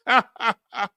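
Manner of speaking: short, high chuckle of separate bursts; laugh. Real, not acted. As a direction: chuckle in a quick run of ha-has. Laughs after mimicking dismissive viewers.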